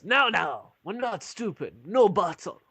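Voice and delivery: high, loud dwarf refusal; refusing dwarf barkeep. Acted. Dwarf barkeep refuses to sell the whole bottle, high and loud. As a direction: shut the idea down flat, with a laugh in it. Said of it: "he goes".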